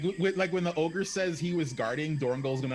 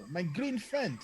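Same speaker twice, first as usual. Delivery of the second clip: yelling; 6 semitones higher than usual. Acted. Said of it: "he's gonna yell".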